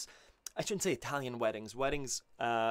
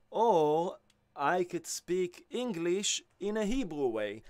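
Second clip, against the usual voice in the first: English in a slow, put-on Hebrew accent; 3 semitones higher, slower than usual. Shown as a demonstration. Teacher demonstrating accent to music students, slow Hebrew accent. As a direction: lean into a Hebrew accent, slower. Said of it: "I'll imitate a, a Hebrew accent".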